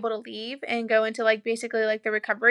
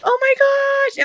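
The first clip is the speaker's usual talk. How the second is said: higher, louder cry of relief, voice breaking; tearful exclamation; 10 dB louder, much slower than usual. Real, not acted. Mother hearing her baby arrive, tearful, higher and louder. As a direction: let it break into tears.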